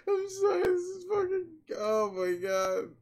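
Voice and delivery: a tearful, high wail of despair; breaking down crying. Acted. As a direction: crack into tears mid-sentence. Breaks down after outburst.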